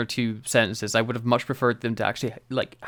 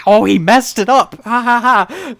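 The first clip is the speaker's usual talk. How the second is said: much higher and louder, a cackling mock giggle; hammed-up gleeful laugh; 10 semitones higher, 10 dB louder than usual. Sarah Jane cackles at the silly Doctor, hammed-up glee. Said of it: "starts laughing to herself and be like".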